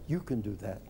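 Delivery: low, hushed, repeated encouragement; soft, deep, quietly convinced; inner voices. Retold or imitated. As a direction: murmur it like a thought dawning. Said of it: "A thousand voices whirled over my head".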